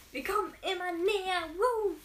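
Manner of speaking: loud, high whoop of joy; excited cheer. Real, not acted. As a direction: cheer the viewers on. Cheering reading progress.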